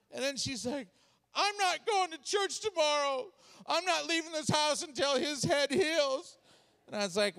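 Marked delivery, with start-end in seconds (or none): 1.3-6.3 high, tearful protest; hammed-up upset wife; distraught wife. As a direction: refuse adamantly in a wailing wife voice. Distraught wife refusing church, acted.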